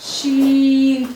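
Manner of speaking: शीन held very long, a light, thin sh; teacher demonstrating a letter; drawn-out sh. Shown as a demonstration. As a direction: stretch the शीन out slowly. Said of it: "बारीक पढ़ेंगे".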